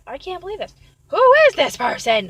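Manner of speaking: mock-outraged disbelief, building to a loud cry; upset. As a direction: imitate someone flabbergasted, exploding on the question. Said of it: "she was getting too upset".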